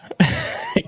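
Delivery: a sudden squeaky laugh fighting back tears; high teary laugh. Real, not acted. Streamer overwhelmed by donations, a high teary laugh. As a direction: let out a very high, choked-up laugh. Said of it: "Keep it together".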